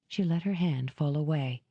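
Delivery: usual speaking voice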